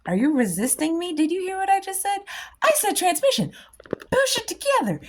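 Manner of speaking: high, insistent scold at the energies; a bossy teacher scolding her pupils; mock scolding. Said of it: "And then you're like".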